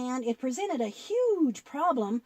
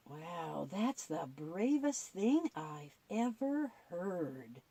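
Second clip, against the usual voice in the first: awed hush; 6 semitones lower, 7 dB quieter, slower than usual. Acted. Puppet awed by the brave answer.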